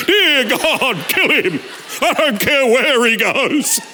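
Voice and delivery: hammed-up wife praying for his death; wife's mock prayer. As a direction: pray it with a giggle, slowly. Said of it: "she wasn't in the bed to hear me pray".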